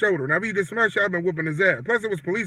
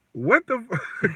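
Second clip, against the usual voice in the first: mock startled outburst; 4 semitones higher, much slower than usual. Startled by stranger's tap.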